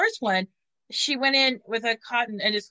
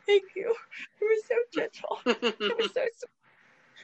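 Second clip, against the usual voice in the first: much higher, sweet gushing voice; gushing thanks; 12 semitones higher than usual. Retold or imitated. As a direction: gush sweetly over how gentle he was. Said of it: "and I was like".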